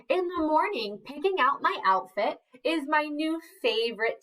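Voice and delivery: high, lilting story opening; storybook read. A narrator's reading. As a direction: read it like a picture book for little kids. Reading the book aloud, high.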